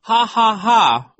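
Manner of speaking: loud, high, slow ha-ha-ha; fake laughter. A narrator's reading. Violet queasily fakes laughter, loud false laugh. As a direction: force each ha out loudly. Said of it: "faking all this laughter".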